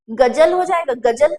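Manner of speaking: गजल with a hard j in the middle, gajal; wrong j. Shown as a demonstration. Teacher testing the Hindi-letter reading, गजल with plain j. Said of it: "क्या उच्चारण सही हो रहा है".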